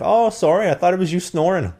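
high mock Irish apology; Irish accent. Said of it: "work on your Irish accent".

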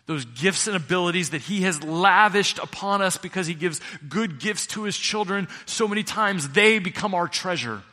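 loud, high, driving pulpit voice; full-force preaching. Acted. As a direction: preach it out to the back row.

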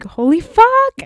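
high gleeful holy fuck; mock hype shriek. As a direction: squeal it like someone overhyped. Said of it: "you're just like".

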